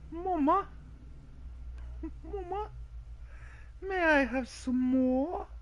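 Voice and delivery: put-on very high child voice; little children. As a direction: plead in a high, drawn-out little voice. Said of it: "children. And they go".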